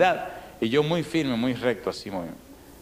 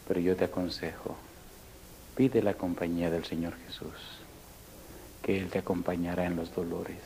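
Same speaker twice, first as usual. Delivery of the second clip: solemn pastoral appeal; 5 semitones lower, 7 dB quieter than usual. Real, not acted. Preacher turning from funny story to counsel listeners, solemnly. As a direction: bring it down to a gentle, measured counsel.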